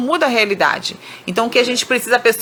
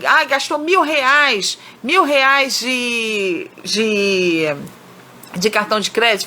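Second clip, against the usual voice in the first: complaining; slower than usual. Shown as a demonstration. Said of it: "ao invés de você reclamar, brigar".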